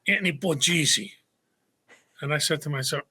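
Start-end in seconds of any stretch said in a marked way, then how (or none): 0.1-1.1 name squashed into Brooklyn speech; imitated Brooklyn neighborhood accent. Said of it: "the Brooklyn accent".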